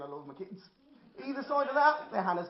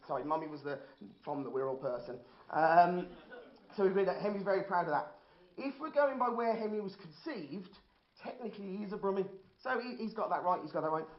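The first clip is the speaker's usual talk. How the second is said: normal talking voice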